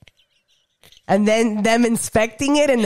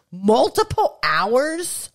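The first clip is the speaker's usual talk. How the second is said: slow, disbelieving echo of "Multiple hours?"; incredulous repeat; 4 semitones higher, much slower than usual. Shown as a demonstration.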